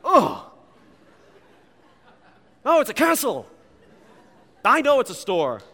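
high, loud mock wail; hammed-up cry of dismay; exasperated outburst. Preacher acting out dad's reaction to his son, exasperated. Acted outburst at the spoiled surprise.

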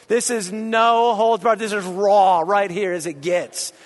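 ordinary talk, nothing marked

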